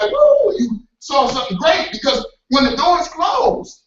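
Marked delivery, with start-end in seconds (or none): 0.0-0.5 high thrilled ooh; excited ooh. Retold or imitated. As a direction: gasp at something great. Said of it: "you was like".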